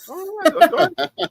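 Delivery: high, laughing go ahead; flustered laughing. Retold or imitated. Dad accepting a hug from a stranger, laughing. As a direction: giggle it out, embarrassed. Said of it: "I'm like".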